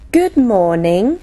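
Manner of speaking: usual speaking voice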